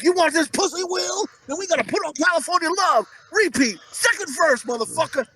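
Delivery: high-pitched bossy wife act; hammed-up demanding lover, pitched right up; Jada Pinkett Smith. Wife dictating the bedroom music, mock bossy demand. Acted Jada ordering Will about.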